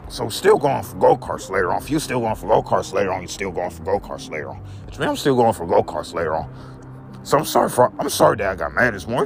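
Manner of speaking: usual speaking voice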